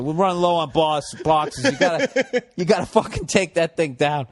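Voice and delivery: mock boss order breaking into a laugh; laughing retelling.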